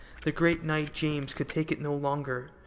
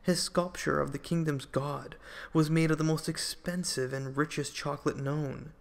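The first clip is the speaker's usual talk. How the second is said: ordinary delivery, nothing marked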